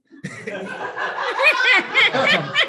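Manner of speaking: loud, high burst of laughter; real laughing fit. Real, not acted. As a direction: crack up at yourself. Laughs at his own story.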